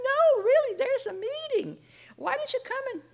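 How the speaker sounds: high, loud insisting friend. Retold or imitated. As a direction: pitch up and push like the earnest friend.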